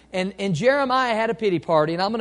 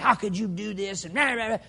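mock scolding, pitched up at the end; mean scolding; 4 semitones higher than usual. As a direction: imitate someone chewing him out. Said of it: "just talking mean to him".

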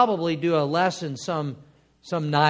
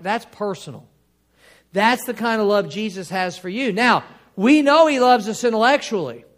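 fiery preaching; 3 semitones higher, 6 dB louder than usual. Acted. Preacher urging the church to feel personal love, loud preaching. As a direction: drive the point home to the congregation.

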